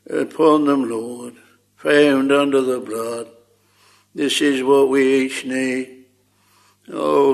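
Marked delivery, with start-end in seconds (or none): none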